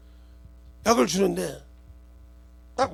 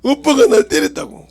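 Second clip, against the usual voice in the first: put-on a teary little girl tattling, higher and slow; whiny tattling child; 4 semitones higher, 6 dB louder, slower than usual. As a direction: whine it out like a crying child telling on her siblings. Youngest child tattling to dad. (울먹이며 이르는 막내 목소리)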